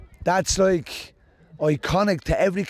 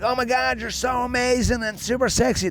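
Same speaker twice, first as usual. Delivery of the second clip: put-on high, gushing American accent; hammed-up American fan accent; 8 semitones higher than usual. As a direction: gush like an excited American fan. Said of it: "this American dude came up to me".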